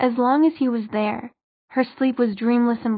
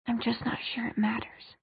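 breathy whisper, barely voiced; 8 dB quieter than usual. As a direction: whisper it to yourself. Clary's quiet doubtful reply.

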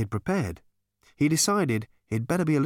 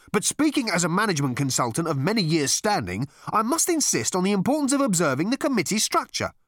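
high-pitched, pompous management consultant; huffy; 8 semitones higher than usual. A narrator's reading. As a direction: insist huffily on proper procedure. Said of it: "said the man huffily".

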